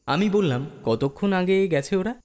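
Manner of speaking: ordinary delivery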